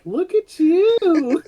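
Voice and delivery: put-on very high, slow baby talk; Buddy cooing at the angry elf.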